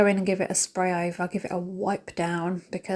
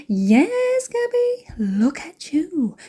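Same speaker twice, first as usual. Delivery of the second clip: high, swooping, drawn-out cooing; mock self-admiration; 9 semitones higher, much slower than usual. Vlogger playfully admiring her own outfit, high mock cooing. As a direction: coo it high, stretching the last words.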